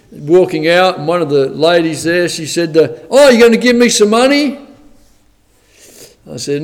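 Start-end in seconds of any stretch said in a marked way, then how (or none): 3.1-4.6 high, incredulous rising question; put-on astonished query; surprised question. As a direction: ask it in pitched-up surprise, imitating her. Retells bank lady's surprised question.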